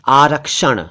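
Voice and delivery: firm k-sh and retroflex n in आरक्षण; correct pronunciation. Shown as a demonstration. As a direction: model आरक्षण emphatically. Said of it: "आरक्षण नहीं".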